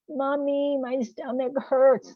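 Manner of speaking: put-on high, whiny little kid complaining; a small child whining to mom that her tummy hurts; six-year-old impersonation. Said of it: "That's my six-year-old impersonation".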